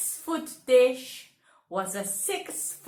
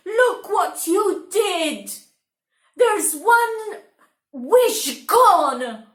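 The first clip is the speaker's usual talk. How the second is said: higher, louder scolding; cross wife; 6 semitones higher, 7 dB louder than usual. Acted. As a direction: scold him for the wasted wish. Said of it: "Lara was very cross".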